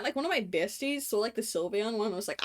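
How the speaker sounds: ordinary talk, nothing marked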